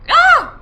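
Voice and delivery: loud, very high yelp; sudden tic yelp. Real, not acted. Tic interrupting a phone call, sudden high yelp. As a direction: yelp sharply out of nowhere.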